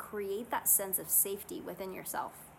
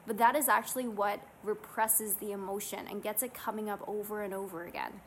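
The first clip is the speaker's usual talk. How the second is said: ordinary talk, nothing marked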